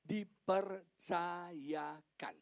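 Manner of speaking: "dipercayakan" drawn out very slowly, syllable by syllable; stress on DIPERCAYAKAN. Shown as a demonstration. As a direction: stretch every syllable of "dipercayakan" for weight. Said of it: "saya mau tekankan sini". ("dipercayakan" ditekankan, diucapkan sangat lambat per suku kata)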